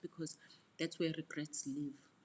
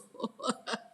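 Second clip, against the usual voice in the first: high, rippling giggle; light peal of laughter; amused laugh; 7 semitones higher than usual. Real, not acted. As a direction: keep giggling at your own joke. Jokes about having demons.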